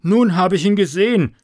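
loud, shaken exclamation; frightened farmer. Acted. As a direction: blurt it out still shocked. Farmer shaken after seeing devil.

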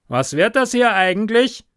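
loud, high, sharp question; whipcrack demand. A narrator's reading. Superior breaking the silence, loud and sharp. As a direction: crack it out like a whip. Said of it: "ebenso ein Peitschenknall".

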